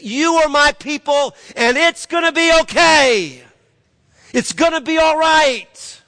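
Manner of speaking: preacher voicing God's promise at the peak; God's promise proclaimed. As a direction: proclaim it as God speaking to his people. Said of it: "The theme is".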